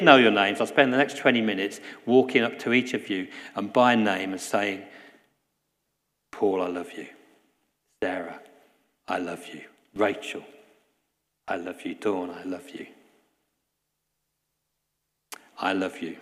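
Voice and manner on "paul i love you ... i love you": tender. Pretend. Preacher voicing personal love by name.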